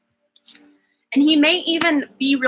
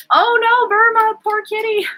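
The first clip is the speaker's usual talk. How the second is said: sympathetic cooing; 9 semitones higher, 4 dB louder than usual. Real, not acted. Reader sees her cat Burma on camera, high sympathetic coo.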